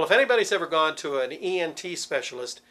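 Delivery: ordinary talk, nothing marked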